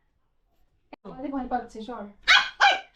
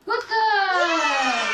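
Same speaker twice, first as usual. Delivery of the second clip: high squeaky praise, louder; squeaky praising a dog; 4 semitones higher, 3 dB louder than usual. Real, not acted. Praising dog after eating.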